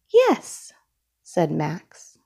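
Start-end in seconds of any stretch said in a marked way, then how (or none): 0.1-0.7 put-on much higher, small child's yes; eager yes. Max answers his sister eagerly, much higher and childlike. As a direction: chirp it like an eager little kid.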